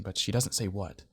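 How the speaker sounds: ordinary delivery, nothing marked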